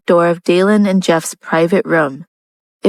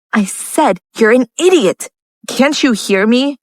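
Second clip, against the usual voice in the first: higher-pitched, exasperated anger; infuriated; 5 semitones higher than usual. A narrator's reading. Lucia scolds Jeff a second time, infuriated. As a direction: throw it back angrily, pitch raised. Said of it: "Lucia was infuriated".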